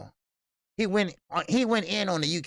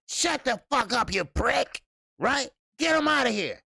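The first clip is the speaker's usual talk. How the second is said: imitating angry promoter; 7 semitones higher than usual. Host imitating the promoter yelling at a reporter, raised pitch. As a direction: ham up the old man's furious brush-off. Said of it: "He said, you know".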